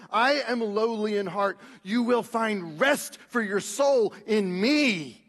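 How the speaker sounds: slow, lifted preaching of Jesus' words; fervent scripture reading. Acted. Preacher voices Jesus to a weary congregation, fervent and slow. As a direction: preach the invitation slowly.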